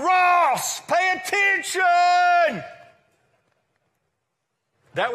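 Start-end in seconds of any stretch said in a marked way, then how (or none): 0.0-2.6 loud, high, drawn-out shout; demonstrative shout. Shown as a demonstration. Preacher showing how God doesn't call Ross, shouting. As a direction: yell it to the back of the room. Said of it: "So God doesn't shout".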